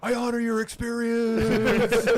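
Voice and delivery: high, drawn-out comic declaration; hammed-up tribute to a dying man; slow, high-voiced mock reverence; mock solemn hero.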